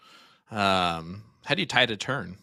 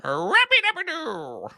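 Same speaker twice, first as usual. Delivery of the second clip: much higher, slow cartoon puppy voice; bad impression. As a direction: squeak it like a cartoon pup. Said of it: "I did a bad impression".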